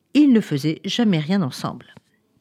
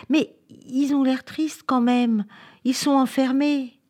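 high, slow little voice of concern; pitying child; 7 semitones higher, slower than usual. A narrator's reading. Anna noticing the apes are locked up, saddened. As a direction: lift the pitch and slow down in pity.

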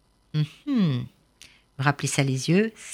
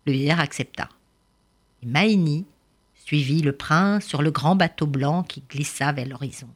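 usual speaking voice

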